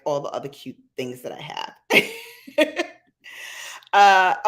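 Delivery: usual speaking voice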